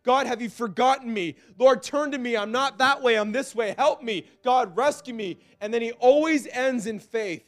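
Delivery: high, loud, impassioned sermon delivery; full-force preaching. Acted. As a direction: preach it hard, pleading with the congregation.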